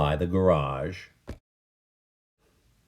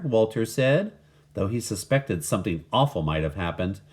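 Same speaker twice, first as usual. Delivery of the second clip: usual speaking voice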